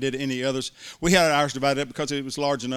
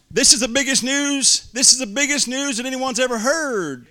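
preaching climax; 11 semitones higher, 8 dB louder than usual. Real, not acted. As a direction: proclaim it to the congregation. Preacher climax, full force.

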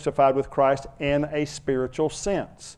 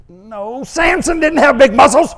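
high, loud protest; indignant shout; 12 semitones higher, 12 dB louder, slower than usual. Retold or imitated. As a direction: cry out a shocked correction. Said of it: "If I say".

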